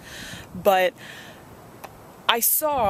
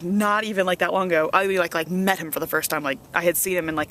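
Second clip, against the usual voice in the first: normal talking voice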